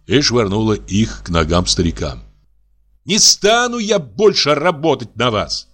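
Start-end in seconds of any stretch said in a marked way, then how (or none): 3.0-5.7 high mock shout of defiance. A narrator's reading. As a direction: hurl it at the old man. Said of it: "крикнула она громко". (высокий наигранный крик)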